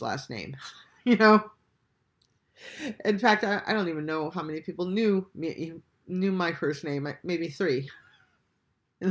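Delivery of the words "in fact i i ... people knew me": usual speaking voice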